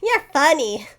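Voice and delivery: lightly voiced old-timey man laughing. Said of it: "an old-timey voice".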